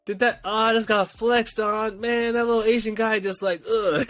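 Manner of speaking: high, mock-stunned groaning; just got flexed on, reacting high and stunned; stunned dismay. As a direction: act floored and deflated. Replaying his dismay after being flexed on.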